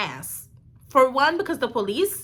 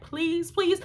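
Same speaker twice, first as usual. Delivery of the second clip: higher-pitched mock tearful plea; tearful pleading; 9 semitones higher than usual. Teen begging the police, tearful pleading. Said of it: "I start crying even more".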